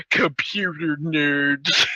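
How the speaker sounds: low, slow put-on voice; mocking character voice. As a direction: drag it out in a deep mocking voice. Mocking retold exclamation.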